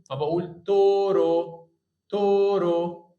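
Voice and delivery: تورو very slow with open أو; slow tutor demo. Shown as a demonstration. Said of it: "هنا أو وهنا أو،". (تورو ببطء شديد وبصوت أعلى)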